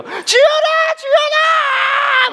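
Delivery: high, drawn-out panicked shriek; imitating mom's frantic yell; screaming a name. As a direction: holler for a lost daughter, stretching each syllable. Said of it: "막 소리를 지르기 시작하는 거예요".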